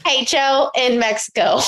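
slow, drawn-out, talking down. Pretend. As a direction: spell it out slowly as if to a child.

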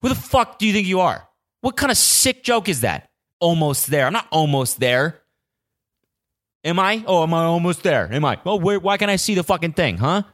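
exhausted runner furious at the crowd; mock outrage. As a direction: rant back at the cheering fans. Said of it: "those were my inner monologues".

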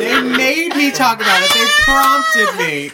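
high, loud squealing yell; mock protest. Speaker defending talk about designer clothes, mock protest. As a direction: yell it in hammed-up defence, rising to a shriek.

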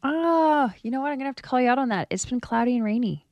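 usual speaking voice

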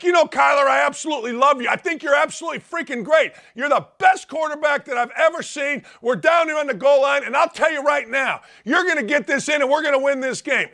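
high-pitched mock yelling of praise; yelling back. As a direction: yell compliments like a fired-up coach. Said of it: "I'm yelling back".

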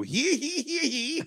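loud, high squealed hee-hees; high-pitched "hee hee". Acted. Joking about emails sent by pigeon, high squealed hee-hees. Mimicking after pigeon service remark.

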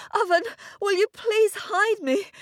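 high breathless plea; out of breath. A narrator's reading. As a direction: beg while panting from running. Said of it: "all out of breath".

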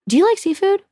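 high-pitched, hopeful ask; lightly acted, high querying tone; imitated date's question. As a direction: imitate the date asking, voice lifted high.

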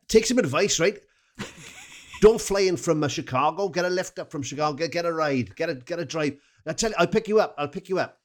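high, sing-song put-on Welsh accent; Pontypridd-Merthyr accent. Fan offering a lift from Chicago, in a high Pontypridd-Merthyr accent. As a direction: advise him in high-pitched Pontypridd. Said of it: "I'll do Miss Pontypridd, uh, slash Merthyr".

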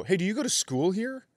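a hint of mock condescension; mock sarcastic question. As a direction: needle the kid with fake interest. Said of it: "I go".

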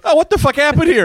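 very high-pitched acted dismay; alarmed mock reaction. Hero walks in on an escape, high mock alarm. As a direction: pitch it way up, walking in on chaos. Acting Bruce Wayne finding an escape.

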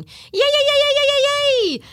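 hammed-up empty cheer, sneering at it; mock cheering. Therapist mocks the retreat's empty celebration, hammed-up cheering.

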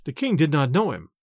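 ordinary delivery, nothing marked

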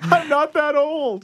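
high, slow mock protest; mock offended. Real, not acted. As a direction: play hurt, drawn out. Reacting to being called older.

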